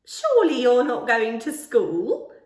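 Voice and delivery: loud, slow, put-on voice of a big kindly woman; slow booming lollipop lady. A narrator's reading. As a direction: boom it out slowly like a startled grown-up.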